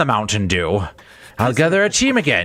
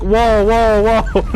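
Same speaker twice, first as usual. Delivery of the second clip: mock whoa; 6 semitones higher, 5 dB louder, slower than usual. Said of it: "It's like".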